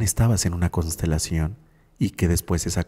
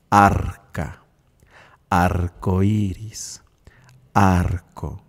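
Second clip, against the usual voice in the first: "Arca", "Arcoíris", "Arco" slowly stretched, leaning on Ar; stressed first syllable; much slower than usual. Shown as a demonstration. Teacher showing the shared syllable, slow, stretched Ar in "Arca", "Arcoíris", "Arco". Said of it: "Esa sílaba ar".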